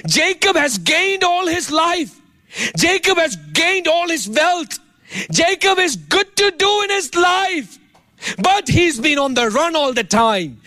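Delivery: high, slowed and shouted sermon lines; full-force preaching. Acted. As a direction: pastor crying out over Jacob's life, preaching hard.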